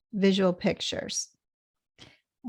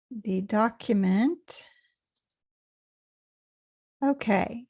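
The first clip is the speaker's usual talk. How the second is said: usual speaking voice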